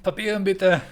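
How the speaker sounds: stern mock German official asking for papers; mock German guard. Streamer playing a German guard demanding papers, mock stern.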